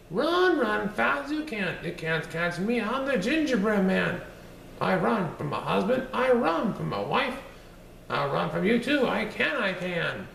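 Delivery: high, sing-song taunting cookie voice; bouncy little gingerbread man bragging; cheeky gingerbread man. Acted. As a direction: tease them from far out of reach. Gingerbread man boasts to butcher.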